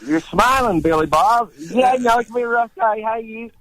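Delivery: high, loud Appalachian hillbilly exchange; caricatured hillbilly accent, upper lip frozen; Eastern Tennessee accent. Shown as a demonstration. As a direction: put on a grinning Tennessee mountain voice. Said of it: "They talk like this".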